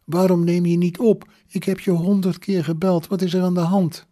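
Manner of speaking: high-pitched, insistent worried questions; agitated scolding. A narrator's reading. Mother who phoned a hundred times, agitated scolding. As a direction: pile up the reproaches in a high voice.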